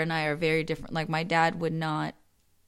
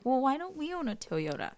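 lightly voiced kid, higher and soft; a hint of a puzzled child asking; kid's questioning; 7 semitones higher, 5 dB quieter than usual. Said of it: "when you're a kid".

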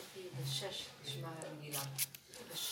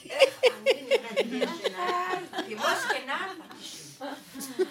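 real laugh bursting through the words, much louder; 11 semitones higher, 18 dB louder than usual. Real, not acted. Laughing to the group about forgetting her teeth, loud laugh. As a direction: crack up while saying it. Laughing at herself without dentures.

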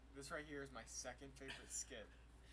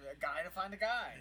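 higher, louder put-on voice; mock barber voice; 6 semitones higher, 12 dB louder than usual. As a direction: echo the barber's line in a goofy voice. Repeats barber line in voice.

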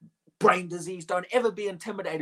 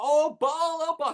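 high, loud call to a god; calling upward in a raised, pleading chant; imitated crying out; 10 semitones higher, 6 dB louder, slower than usual. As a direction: cry out as mock worshippers, pitch lifted. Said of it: "they cried on Baal".

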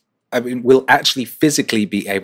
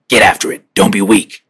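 louder barked coach order; a drill sergeant voice, louder than usual; Jocko; 7 dB louder than usual. Retold or imitated. Imagined coach yelling at him to do push-ups. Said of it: "I could see him in the room going".

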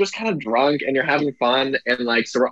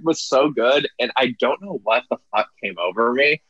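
normal talking voice

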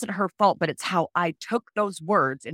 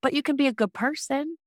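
much higher, pleading inner voice; higher, hopeful inner protest; plaintive self; 10 semitones higher than usual. Retold or imitated. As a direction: plead with yourself, pitched way up. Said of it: "And I'm like".

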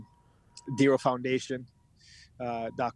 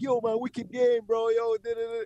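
much higher, excited buddy voice; hyped friend; 10 semitones higher, 3 dB louder than usual. Retold or imitated. Friend hyping him up after the game, much higher and excited. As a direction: gush like a friend praising the game. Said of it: "while your friend's like".

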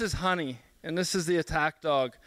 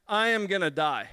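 normal talking voice

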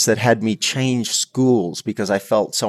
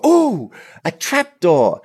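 high, slow mock theatrical gasp; mocking imitation; 8 semitones higher, 4 dB louder, slower than usual. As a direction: tease it like playground kids. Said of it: "they were making fun of me".

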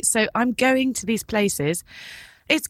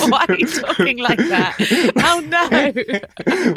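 laughing quote; 4 semitones higher, 5 dB louder, slower than usual. Retold or imitated. Laughing quoting wife.

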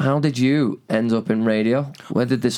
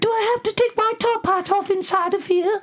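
very high, fluting posh British voice; a prim English gent gasping at the entrance, posh British; squeaky upper-class British exclamation; posh British accent. Acted. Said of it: "You gave my penis a British accent".

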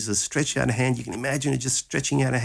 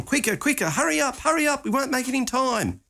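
urgent father. Acted. Jairus urging Jesus on.

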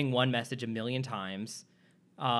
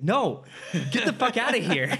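higher, louder yell breaking into a laugh; louder angry teacher; 8 semitones higher, 5 dB louder than usual. Retold or imitated. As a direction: shout it, laughing through. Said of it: "It's like".

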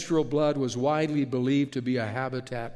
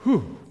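loud whew; 3 semitones higher, 5 dB louder than usual. Real, not acted. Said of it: "reacting after the reading".

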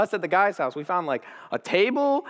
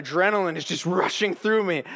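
ordinary talk

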